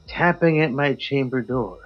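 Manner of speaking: ordinary delivery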